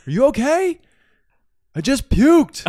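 imitated shouting exchange, high and loud; yelling back. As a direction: call it out as if across a distance. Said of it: "He yells back".

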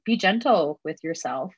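ordinary talk

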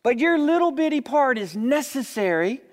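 fervent sermon appeal; preaching. Acted. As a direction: lift the congregation, building fervour. Preacher applies story to congregation.

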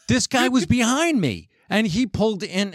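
high-pitched mock protest; indignant protest. Retold quarrel, defending himself.

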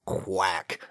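breathy then low quacking duck; duck quacks. Acted. Duck sounds in skit.

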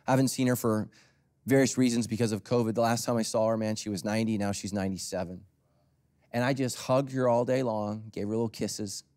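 ordinary talk

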